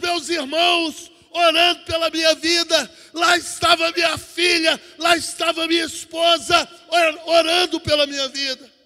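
loud, driving Pentecostal testimony; forceful pulpit delivery; impassioned preaching. Acted. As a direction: preach it out to the whole church.

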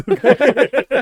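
laughing, high and loud. Real, not acted. Laughing at Rice Krispies.

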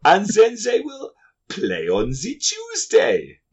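put-on hammy German accent; fake German accent. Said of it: "fake German accent".

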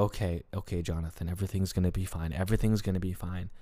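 low, hushed, hurried self-talk; quickly calm yourself under your breath; soft, rapid pep talk to himself; anxious self-reassurance. Retold or imitated. Reassuring himself in retold panic.

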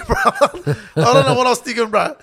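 high, loud laughing disbelief. Real, not acted. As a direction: laugh at your own foolishness while talking. Laughs at his own past behaviour.

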